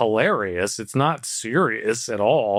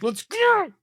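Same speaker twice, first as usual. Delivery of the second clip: high, whiny impatient; fed up, urging traffic on. Retold or imitated. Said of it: "And it's like".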